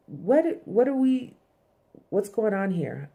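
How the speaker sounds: scrambling to catch up, panicked; startled, rising what what; shocked confusion. Retold or imitated. Mo blindsided, being fired.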